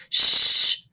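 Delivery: sharp whispered shush; hushing shh. Acted. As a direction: hush the boy. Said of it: "whispered Grandpa Joe".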